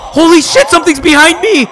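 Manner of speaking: high, loud shriek of alarm; panicked shout. Real, not acted. Streamer spotting something behind him, panicked shout. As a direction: yell as it grabs you from behind.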